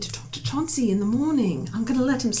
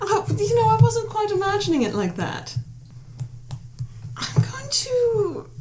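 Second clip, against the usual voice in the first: choked up; 11 semitones higher than usual. Acted. Wavering, distressed in-character reply.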